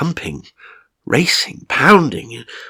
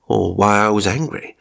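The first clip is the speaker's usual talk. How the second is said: ordinary delivery, nothing marked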